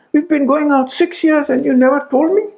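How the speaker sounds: high retold indignation; indignant reproach. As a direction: scold him for hiding it. Fiancée accusing after six years.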